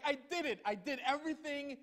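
high, proud, eager claim; eager Saul. Retold or imitated. Saul reporting to the prophet, eagerly proud. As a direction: sound thrilled with yourself. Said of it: "he's like".

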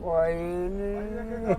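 high, slow, drawn-out tearful answer; breaking into tears. Real, not acted. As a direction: voice rising into crying. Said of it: "ਨਾ ਬਾਪੂ". (ਰੋਣ ਲੱਗਦੀ ਆਵਾਜ਼)

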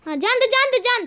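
high, eager egging on; excited urging. Real, not acted. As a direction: urge him on, pitched high. Urging character onward. (जोश से उकसाना)

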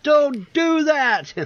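high, drawn-out retold scream; exasperated scream at a screen, acted out; screaming at the monitor. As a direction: stretch it out in a high-pitched yell. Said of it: "screaming at my monitor".

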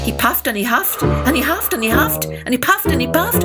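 usual speaking voice

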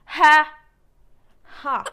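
loud, clipped challenging shout; mock K-pop attitude; defiant poker-face "Ha!". Shown as a demonstration. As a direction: bark it like picking a fight. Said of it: "parang poker face".

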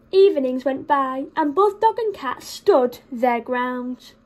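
ordinary delivery, nothing marked

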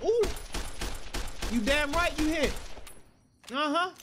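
loud, high-pitched gloating; taunting shout. Real, not acted. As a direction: crow at the enemy you just hit. Taunting enemy in firefight.

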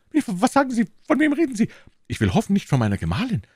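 a frightened little merchant blurting out; alarmed shopkeeper. Acted. Shopkeeper Bonacieux fearing for his wife, high alarmed voice.

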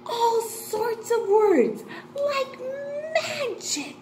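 high-pitched, drawn-out awe; wondrous delight. Pretend. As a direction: marvel at it for young children. Teacher builds wonder about words.

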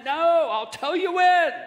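high, loud, drawn-out mock scolding; exasperated refusal. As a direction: shut down the pestering in a slow shout. Jesus scolding pestering fish.

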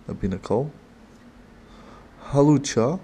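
ordinary talk, nothing marked

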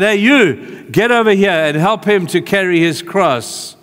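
loud, high commanding call; barked order. Retold or imitated. Soldiers ordering Simon to help Jesus, barked order. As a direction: call out roughly to a bystander.

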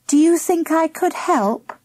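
high, meek request; tentative girl's humble question. A narrator's reading. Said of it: "asked humbly".

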